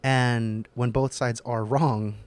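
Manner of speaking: ordinary talk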